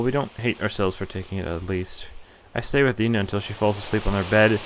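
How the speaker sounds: normal talking voice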